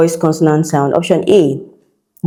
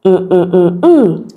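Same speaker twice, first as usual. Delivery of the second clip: humming the word; slower than usual. Shown as a demonstration. Teacher hums option B to test it. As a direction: hum the word's rhythm on mm, last hum rising. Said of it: "Look at option B".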